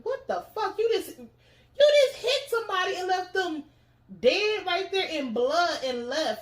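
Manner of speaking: high-pitched mock outrage, stretching out the end; outraged father. Father learns his son left someone for dead, outraged. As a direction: imitate an appalled dad scolding his son.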